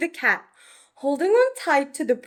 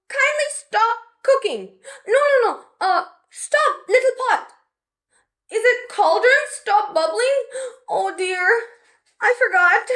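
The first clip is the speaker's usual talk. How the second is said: higher and louder, guessing spells; fumbling for the spell in a panic; panicked little witch; 9 semitones higher, 4 dB louder than usual. Acted. Betty trying spells on the cauldron, higher and panicky.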